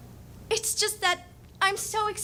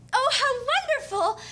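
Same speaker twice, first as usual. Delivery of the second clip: bright, high, louder burst of delight; delighted cry; 7 semitones higher, 4 dB louder than usual. Acted. Sabrina thrilled to be recognized, excited high cry. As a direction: gasp it out with pure joy.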